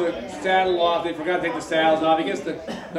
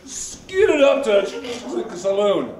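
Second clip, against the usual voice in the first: dramatic storytelling; 5 semitones higher, 4 dB louder, slower than usual. Retold or imitated. Storyteller acts out skid.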